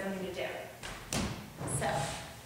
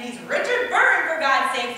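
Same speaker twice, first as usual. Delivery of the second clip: louder, higher exasperation; exasperated; 4 semitones higher, 8 dB louder than usual. Real, not acted. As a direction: throw up your hands. Exasperated outburst.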